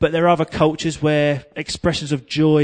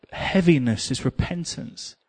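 normal talking voice

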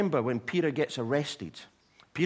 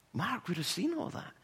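ordinary delivery

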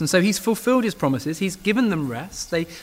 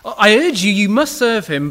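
much louder and higher, pressing the demand; urgent plea; 4 semitones higher, 9 dB louder than usual. Retold or imitated. Joshua urging his people to serve the Lord, loud and pressing. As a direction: urge them hard, nearly calling out. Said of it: "Joshua says to the people".

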